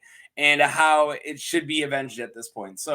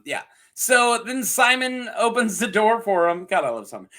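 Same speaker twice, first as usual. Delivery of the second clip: normal talking voice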